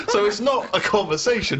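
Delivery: high laugh spilling into words; can't stop laughing. Real, not acted. Host laughs at the story.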